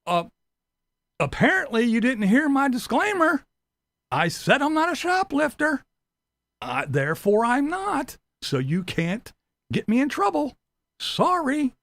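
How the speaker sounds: high, smug, pleased with his own logic; mock-innocent shoplifter; smug mock excuse. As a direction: play the caught thief smugly.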